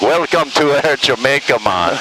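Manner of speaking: put-on lilting Jamaican accent, relaxed; Jamaican pilot voice. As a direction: lay back like a Jamaican pilot on the intercom. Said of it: "reassuring pilot voice".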